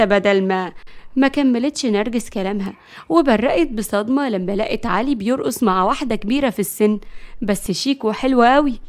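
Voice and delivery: ordinary delivery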